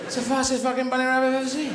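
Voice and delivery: high-pitched exasperated marvelling; amazed greyhound. Acted. Greyhound interviewed after the race, high amazed complaint.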